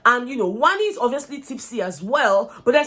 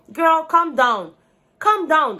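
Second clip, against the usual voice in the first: slow, higher and louder, drawn-out "calm down"; firm calm down; 5 semitones higher, 4 dB louder, much slower than usual. Retold or imitated. Vlogger urging the upset twin to settle, slow and firm. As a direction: plead slowly and firmly for her to settle.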